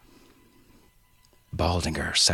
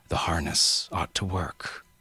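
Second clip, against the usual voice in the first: usual speaking voice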